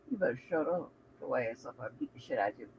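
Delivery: put-on low hushed threat to a child; muttered threat. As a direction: drop low and hiss the threat. Mother threatening kid under breath.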